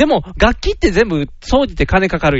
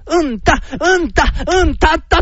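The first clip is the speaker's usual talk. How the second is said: high rhythmic "un-ta, un-ta"; imitated castanet rhythm; 8 semitones higher, slower than usual. Imagining castanet practice at home, chanted rhythm. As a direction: chant out a beginner's castanet beat. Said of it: "カスタネット".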